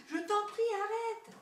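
high-pitched desperate pleading; panicked high plea; terrified yell. A narrator's reading. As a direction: beg it to stop, loud at first and very high. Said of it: "hurla la vieille femme terrifiée".

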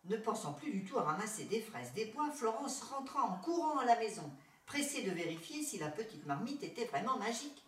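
ordinary delivery, nothing marked

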